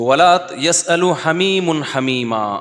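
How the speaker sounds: tajweed recitation; Quran recitation. Acted. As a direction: recite melodically.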